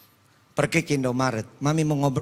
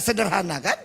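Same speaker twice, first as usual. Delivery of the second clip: ordinary talk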